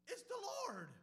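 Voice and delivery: high loud cry of recognition; excited shout. Acted. John in the boat shouting to the others, high and loud. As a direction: yell it out, thrilled to see him.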